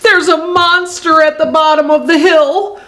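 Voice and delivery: high, alarmed storybook voice; mildly panicked; frightened report. A narrator's reading. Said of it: "so out of breath that he can hardly speak".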